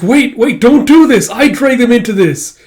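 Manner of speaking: high, loud cry to stop; acted frantic begging; desperate plea. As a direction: plead urgently, voice pitched far up. Princess pleads to stop attack.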